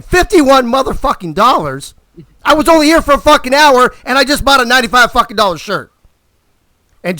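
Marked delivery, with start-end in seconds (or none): 0.0-5.9 high, loud, ranting at the machine; furious rant. Retold or imitated. At a second parking machine, a loud, high furious rant. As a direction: throw a full temper tantrum. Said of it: "And I say again".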